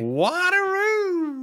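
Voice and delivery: very slow, high sung-out name; drawn-out high echo. Pretend. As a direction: stretch the name out high and long.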